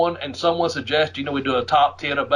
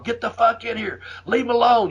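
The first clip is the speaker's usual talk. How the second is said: high mock yell to his partner; retold yelling; 7 semitones higher than usual. Urging Buddy into the ring, high mock yell. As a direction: call him in, pitched way up. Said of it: "I'm just yelling".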